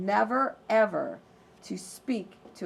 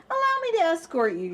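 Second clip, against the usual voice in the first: higher, fast and eager wolf; smooth wolf; 8 semitones higher, 4 dB louder, faster than usual. Acted. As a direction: rush the gallant offer. Wolf offers escort.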